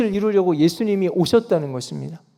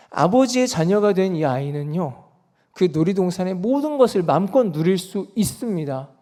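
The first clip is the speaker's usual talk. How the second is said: normal talking voice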